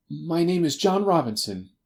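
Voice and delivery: high, hesitant character voice; nervous stranger dodging the question; evasive little man. A narrator's reading. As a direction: give a false name, pitched high. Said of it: "with a sidelong glance".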